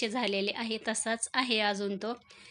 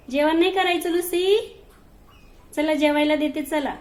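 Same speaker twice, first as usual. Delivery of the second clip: higher, louder pet-talk invitation; coaxing the cats; 6 semitones higher, 8 dB louder than usual. Real, not acted. Woman coaxes her cats to come eat, high and loud. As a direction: sweet-talk the cats toward dinner.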